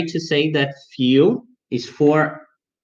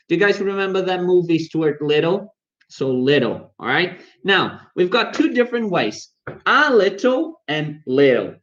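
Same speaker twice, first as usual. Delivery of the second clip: ordinary talk, nothing marked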